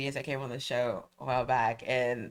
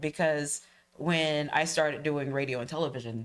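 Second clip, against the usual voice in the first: ordinary talk, nothing marked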